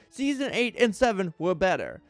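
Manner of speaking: ordinary delivery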